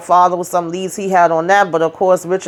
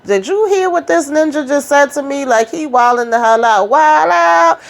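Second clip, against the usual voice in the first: high, put-on voice of a scandalized gossip, rising to a squeal; sassy gossiping woman; 8 semitones higher than usual. Friend retelling the boss's rant, in a high mock-outraged voice. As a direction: act out the outraged friend, pitched well up.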